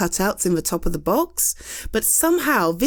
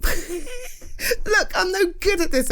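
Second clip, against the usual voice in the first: laughing; 11 semitones higher, slower than usual. Real, not acted. Laughing at own clumsy example.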